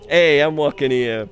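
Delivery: slow, loud mock New York street accent; New York cabbie. Player joking about New York in game, mock New York accent. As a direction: lay on a hammed-up New Yorker drawl.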